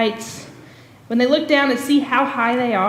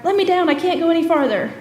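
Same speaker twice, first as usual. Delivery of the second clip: higher, mock-frightened plea; lightly acted terror, higher voice; imitating a scared kid; 5 semitones higher than usual. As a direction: act out a kid begging to come down. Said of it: "he said this the whole forty feet up".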